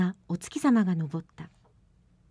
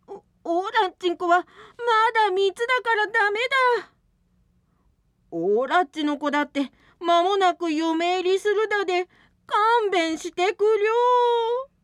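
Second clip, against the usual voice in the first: pleading; 10 semitones higher, 4 dB louder than usual. Acted. Parents pleading to spare daughters.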